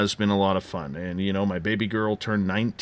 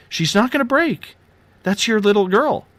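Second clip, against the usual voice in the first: high-pitched retold nurse voice; imitated reassuring nurse; 10 semitones higher than usual. Nurse to first-time father about his newborn, imitated high reassurance. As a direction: coax a nervous parent, pitched well up.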